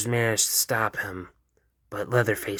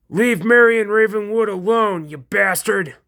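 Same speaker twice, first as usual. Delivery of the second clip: much higher, loud, defiant yell; angry shout; 11 semitones higher, 8 dB louder than usual. Acted. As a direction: yell it at the monster. Denji confronts the killer.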